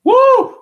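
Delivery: sudden high loud venting whoop; angry whoop. Real, not acted. Streamer furious at diabetes numbers, high loud whoop. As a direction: let the frustration burst out in a yell.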